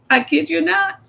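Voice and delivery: normal talking voice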